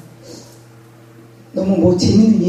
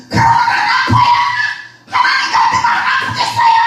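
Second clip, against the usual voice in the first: much higher, shrieking protest; shouting at God. Retold or imitated. Pastor shouting back at God in her grief, shrieking protest. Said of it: "그리고 제가 소리를 질렀다고요".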